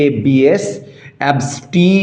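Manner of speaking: normal talking voice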